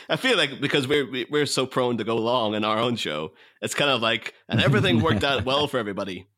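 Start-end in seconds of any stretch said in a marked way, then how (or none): none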